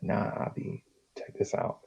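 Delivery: secretive hushed whisper. Retold or imitated. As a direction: breathe it so no one hears. Friend revealing the herb.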